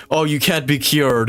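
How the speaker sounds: usual speaking voice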